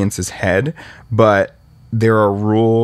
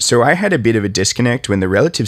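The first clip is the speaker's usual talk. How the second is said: usual speaking voice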